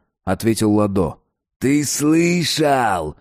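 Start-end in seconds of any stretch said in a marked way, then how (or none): 1.6-3.2 higher, very drawn-out menacing yell. A narrator's reading. Satan turns back on the dealer, yelling. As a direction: stretch the question into a threatening cry. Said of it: "Ещё громче завопил сатана".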